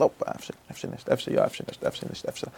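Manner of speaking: rapid hushed back-and-forth, nearly voiceless; very quick. Shown as a demonstration. Teacher showing how fast the mind flips, quick and hushed. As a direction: rattle it off softly under your breath. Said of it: "es geht sehr schnell".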